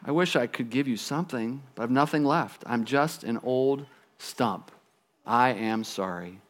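soft, slowing, regretful apology; sighing tree. Acted. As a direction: apologize as a worn-out old stump, voice sinking. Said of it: "sighed the tree".

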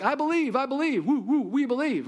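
mock-excited, high-pitched cheer; eager disciples. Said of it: "the disciples were like".

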